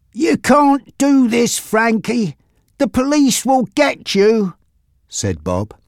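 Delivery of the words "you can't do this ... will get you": high, slow protest; frightened mechanic Bob. Acted. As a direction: warn the gangster off in a raised pitch.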